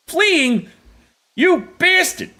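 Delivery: high, loud outburst at the fleeing deer; high-pitched angry shout; frustrated yell. Real, not acted. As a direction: yell it out, real annoyance. Deer runs off while hunting.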